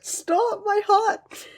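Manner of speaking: a fan overwhelmed, squeaking; mock heartbreak. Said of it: "And I'm like".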